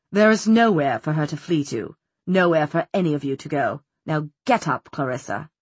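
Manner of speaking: low, calm, icy villain; cold, still voice. A narrator's reading. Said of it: "in his cold, still voice".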